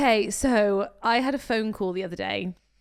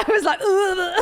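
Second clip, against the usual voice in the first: laughing groan; 7 semitones higher, 7 dB louder, slower than usual. Real, not acted. Laughing at recalled reply.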